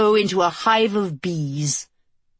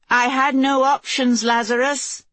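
higher, lifted calling voice; calling across distance; 7 semitones higher than usual. A narrator's reading. The Queen to her guard across the chasm, calling out. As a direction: send the words over a chasm. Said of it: "the Queen called across the chasm".

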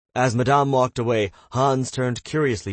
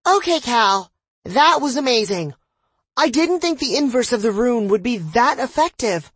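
put-on high, loud, thrilled young voice; high excited girl; 7 dB louder than usual. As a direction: gush in a bright high voice. Danny character voice.

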